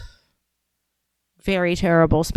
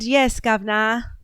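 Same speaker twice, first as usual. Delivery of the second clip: put-on British accent; 2 semitones higher than usual. Answering a chat viewer in a playful British accent. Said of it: "every time I do my British accent".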